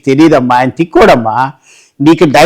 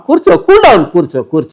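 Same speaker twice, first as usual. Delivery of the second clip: much higher, urgent call to sit; sharp calm-down call; 5 semitones higher than usual. Acted. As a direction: pitch it up to stop the fight. Lawyer calms the fighting wife.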